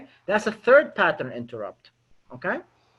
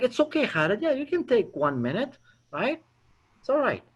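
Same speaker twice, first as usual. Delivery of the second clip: higher, gentle voice of reassurance; reassuring rescue; 5 semitones higher, 4 dB quieter than usual. Acted. Prospect rescuing the uneasy caller, reassuring. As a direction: let him off kindly, pitched up. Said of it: "feel compelled to rescue me".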